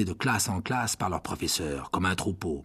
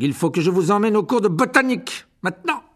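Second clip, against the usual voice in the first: much higher, loud barking command; barked order; 10 semitones higher, 7 dB louder than usual. A narrator's reading. Teacher ordering the class to herbology, loud bark. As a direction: drive them out the door. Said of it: "aboya Rogue".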